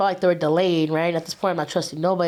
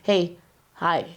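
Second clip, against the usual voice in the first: short, restrained hello with no lift; stressed, subdued greeting. Retold or imitated. Answering the homie's call while in trouble, stressed and subdued. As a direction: answer guardedly, holding back the usual cheer. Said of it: "when I'm stressed, mad, upset, or worried".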